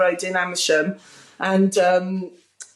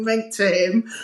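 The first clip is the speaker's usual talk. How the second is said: ordinary talk, nothing marked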